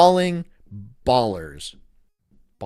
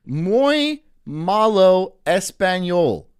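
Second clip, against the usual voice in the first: slow, hammed-up gringo Spanish, louder; over-pronounce the Spanish like a tourist; shitty Spanish; 5 semitones higher, 6 dB louder, much slower than usual. Streamer jokes he'll stream in bad Spanish, slow mock gringo accent. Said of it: "in very shitty Spanish".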